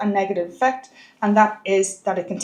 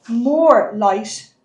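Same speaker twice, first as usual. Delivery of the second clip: slow, stretched-out "more"; stress on "more"; 3 dB louder than usual. Real, not acted. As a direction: draw out "more" with extra weight before "light". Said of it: "I'm emphasizing the word more".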